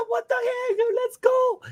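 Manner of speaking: very high squeaky dog voice; high-pitched mock panic; talking scared dog. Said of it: "So he's like".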